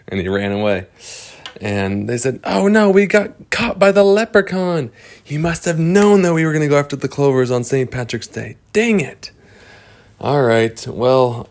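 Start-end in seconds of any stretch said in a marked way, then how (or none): 2.4-9.3 higher voice, frustrated and fast; high fast grumbling character; high dismayed character. Acted. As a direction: complain about being caught. Characters caught by leprechaun.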